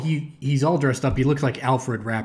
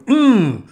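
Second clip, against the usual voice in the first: crowd callback hum; 8 semitones higher, 5 dB louder than usual. Shown as a demonstration. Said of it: "the crowd callback".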